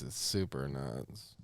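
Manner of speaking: fading low mumble; deadpan murmur. Pretend. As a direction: mutter it flat, trailing off. Deadpan fading small talk.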